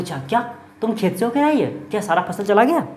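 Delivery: high-pitched, acted disbelief; startled questioning. Halku wakes and asks Munni about crops, startled.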